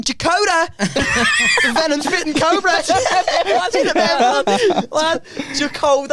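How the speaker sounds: laughing recall. Real, not acted. Laughing recalling X Factor audition.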